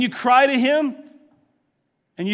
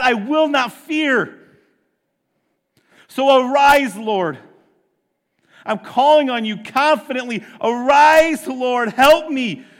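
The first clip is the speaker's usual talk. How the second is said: fervent preaching; 4 semitones higher, 5 dB louder than usual. Acted. Preacher leading the church in a fervent, loud cry. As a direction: preach it out loud, full force.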